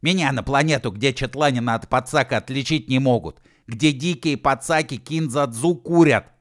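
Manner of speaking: loud, high indignant rant; outraged tirade. A narrator's reading. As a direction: rant at the insult, building force. Outraged tirade after roaring.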